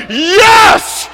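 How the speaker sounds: high, drawn-out cry of delight; triumphant yell. Retold or imitated. Learning he is a diplomat, triumphant yell. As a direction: stretch one word into a victory shout. Retold joy at becoming consul.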